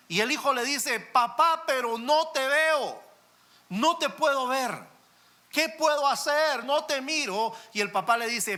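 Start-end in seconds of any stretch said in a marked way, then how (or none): 1.0-7.5 put-on high, loud, fearful child's voice; a scared boy who can't see his dad; high, loud cry of a lost, scared child; frightened child. Trapped child at window.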